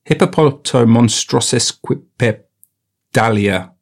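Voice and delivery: slow, halting syllables; sounding it out. Real, not acted. Retaking the long word, halting and sounded out. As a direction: piece "hippopotamonstrosequipedalia" together slowly.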